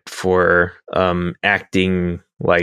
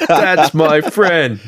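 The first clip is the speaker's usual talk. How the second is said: slow, higher, hammed-up defensive captain; mock Picard; 6 semitones higher, much slower than usual. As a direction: drag it out like an offended captain. Picard voice echoed by friend.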